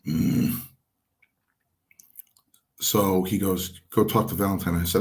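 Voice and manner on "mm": low, imitated irritated "mm"; Strongbow. Wrestler shows his second father's habit, irritated grunt. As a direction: grumble wordlessly in mild annoyance. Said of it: "That's all he would do".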